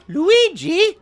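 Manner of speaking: very high squeaky cartoon cry of the name; piping Italian cartoon voice; high cartoon calling voice. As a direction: squeal the name like a game character. Cartoon voice after Luigi's Mansion talk.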